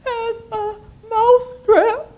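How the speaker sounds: high, stuttering, tearful squeak; stammering tearful mouse. Acted. Mouse stammering tearfully to the cow. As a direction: stammer through tears. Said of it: "The mouse said".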